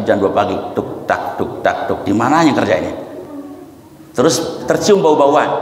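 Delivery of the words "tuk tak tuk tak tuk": soft unvoiced "tuk tak" knocks; knocking sound. Preacher imitating ghostly late-night knocking, soft and unvoiced. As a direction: tap out the night knocking with your mouth, softly. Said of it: "Suara tukang kerja jam satu".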